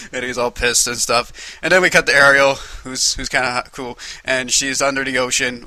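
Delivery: ordinary talk, nothing marked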